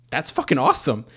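loud burst of approval; retold excited reaction. He answers his partner's pregnancy news, retold excited reaction.

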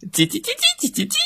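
very high, fast, soft cheeping chirps; chick chirping. Acted. Chicks drowning out the teacher with fast cheeping. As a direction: cheep like a crowd of baby chicks.

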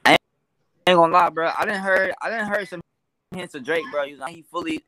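ordinary talk, nothing marked

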